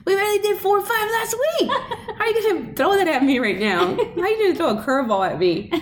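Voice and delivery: high, indignant student protest; mock outrage. Student protesting the teacher's new times table, high mock outrage. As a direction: complain like a kid wronged by homework.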